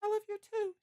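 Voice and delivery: very high falsetto voice; Licia whispering. A narrator's reading. Licia returns Bill's love, high falsetto. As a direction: answer lovingly in high falsetto. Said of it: "she whispers".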